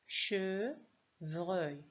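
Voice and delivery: "Chevreuil" in two separate syllables, che and vreuil; découpage en syllabes. Shown as a demonstration. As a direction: break "Chevreuil" after che with a short pause. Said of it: "Deux syllabes". (« Chevreuil » en deux syllabes séparées : che, vreuil)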